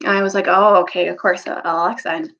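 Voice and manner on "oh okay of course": ordinary delivery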